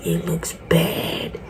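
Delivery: whisper. Real, not acted. Judging wig under lighting.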